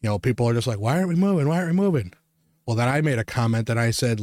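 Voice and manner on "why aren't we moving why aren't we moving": higher-pitched imitation of impatient complaining; impatient shoppers. Waiting shoppers complain about the blocked aisle, impatient mimicry. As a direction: mimic a crowd asking impatiently.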